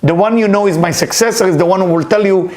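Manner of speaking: ordinary talk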